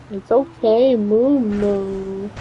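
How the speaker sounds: gently reassuring the little one. Real, not acted. Soothing the baby again.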